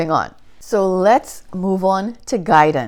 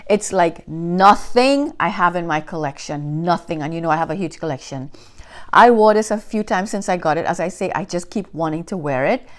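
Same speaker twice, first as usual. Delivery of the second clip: usual speaking voice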